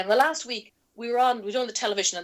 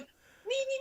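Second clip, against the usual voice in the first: mock eager clamour; 8 dB quieter than usual. Other candidates clamouring to speak.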